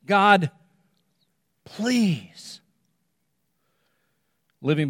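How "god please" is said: imitating Paul begging God, a touch louder; Paul pleading. Paul on his knees begging God to take the pain, pleading. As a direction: plead over and over, pressing harder. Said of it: "pleading with God again and again".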